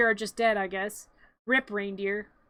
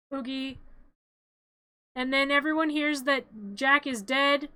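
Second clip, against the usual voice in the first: usual speaking voice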